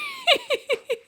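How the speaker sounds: high, squealing burst of laughter; disbelieving squeal of a laugh. Real, not acted. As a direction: crack up at the absurd plot. Can't believe page three abduction.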